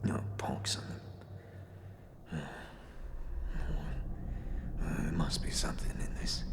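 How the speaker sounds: hushed, breathy search; whispering to himself. Acted. Corpse alone, whispering as he searches.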